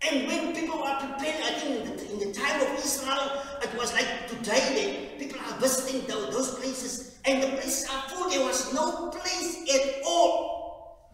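high, loud fervent preaching; preaching at full force. Acted. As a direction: proclaim it to the whole church, loud and high.

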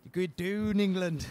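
playful Scottish taunt with long "oo"; amateur mock-Scottish battle jibe; bad Scottish accent. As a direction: taunt them in a badly faked Scottish accent. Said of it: "I can't do a Scottish accent".

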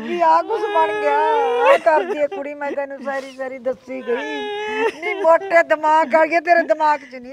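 high, loud, tearful mock lament; hammed-up wailing scold. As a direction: wail the blame at her sister. Wailing scolding to her sister. (ਉੱਚੀ ਨਾਟਕੀ ਰੋਂਦੀ ਝਿੜਕ)

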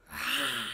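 low lion roar; playful low-pitched roar. Shown as a demonstration. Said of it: "Good roaring like a lion".

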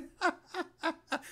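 very high fake cackle; mock Satan laugh. Imitating Satan laughing at a yawner, high mock cackle. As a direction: cackle in short shrieking bursts. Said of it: "Satan laugh at him".

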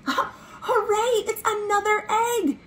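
high laughing hooray; thrilled discovery for the kids; high laughing cheer; excited cheer. Acted. Finding egg behind reeds.

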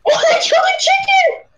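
high shocked scream; scream into giggles. Real, not acted. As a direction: shriek at the shot chicken. Chicken shot by skeleton.

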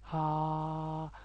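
ها held long and breathy with mouth open; long open H sound. Shown as a demonstration. Teacher showing the H sound, long ها. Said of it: "صوت طويل".

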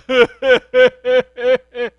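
loud mock cackle from the bush; imitated laugh. Thrown student lying in the bush, loud crazy laugh. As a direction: laugh like the crazed guy below. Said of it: "he's laying down there going".

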